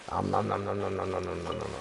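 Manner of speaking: sing-song munching noises; hammed-up gobbling sounds; playful om nom nom. Playful eating noise at fish.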